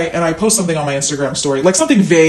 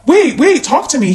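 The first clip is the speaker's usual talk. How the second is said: high, raised-voice plea; urgent calling; 9 semitones higher, 4 dB louder than usual. Retold or imitated. Woman re-enacting chasing her date out, urgent high plea. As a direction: call after him, pitched well up.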